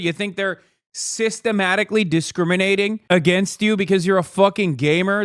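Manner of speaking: ordinary delivery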